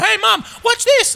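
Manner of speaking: put-on high-pitched kid calling Mum; little kid. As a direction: mimic an excited child showing off. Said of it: "like a little kid going".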